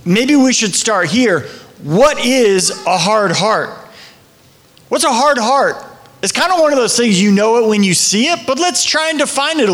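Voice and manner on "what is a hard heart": slow, weighty question with each word spaced. Acted. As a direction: lay the question down slowly and heavily. Pastor repeats key question.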